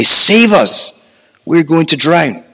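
loud, high cry for rescue; desperate shout. Acted. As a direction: shout it like disciples in a storm. Acting out disciples' plea in storm.

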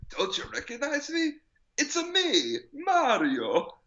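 high, hammed-up Italian accent; Mario Italian accent. Uncle asking Ezio to recognize him, high hammed-up Italian accent. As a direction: squeak it out like a cartoon plumber. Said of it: "It's like".

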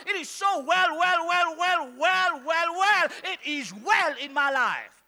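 shouted, high and loud, repeating well; joyful shout. Real, not acted. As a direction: shout it out in triumph. Preacher shouting his faith.

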